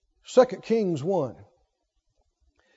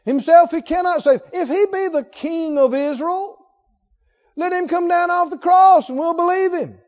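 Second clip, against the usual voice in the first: high, raised-voice taunt; mocking; 6 semitones higher, 4 dB louder than usual. Chief priests taunting Jesus on the cross, mocking. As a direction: jeer at someone who claims to be king. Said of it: "They're mocking him".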